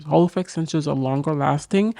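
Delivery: usual speaking voice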